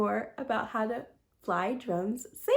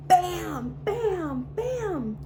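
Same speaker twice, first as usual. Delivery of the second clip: punchy bam rising higher each time; bam sound effect; 6 semitones higher, 3 dB louder, much slower than usual. Acted. Reader voicing Dewey's crashes for kids, rising punchy bams. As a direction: bang out each crash, climbing up.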